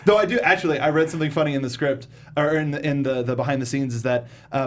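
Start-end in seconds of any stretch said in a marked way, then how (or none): none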